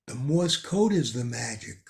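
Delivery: normal talking voice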